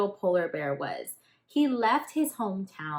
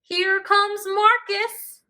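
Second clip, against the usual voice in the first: much higher, louder and slow call; 12 semitones higher, 8 dB louder, slower than usual. Acted. Henry calling out as the eagle swoops down, high slow call. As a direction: call it up to the sky like spotting a friend. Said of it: "at last he called".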